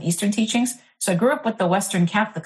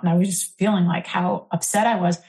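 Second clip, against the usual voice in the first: usual speaking voice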